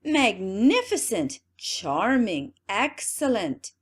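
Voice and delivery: loud, slow exclamations; a crowd of flatterers cheering; ringing praise. Acted. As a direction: toss each compliment out grandly. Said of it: "resounded on all sides".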